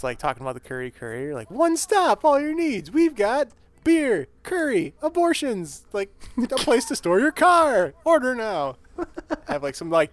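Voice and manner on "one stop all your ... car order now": pitchy, pumped-up TV pitchman; home shopping host. Retold or imitated. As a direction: sell it like a late-night local ad. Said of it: "used car commercials you see on local television".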